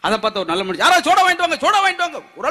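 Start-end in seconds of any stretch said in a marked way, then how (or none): 0.8-2.2 high urgent call into a crowd; calling out for soda. Retold or imitated. Man calls for soda for fainted man.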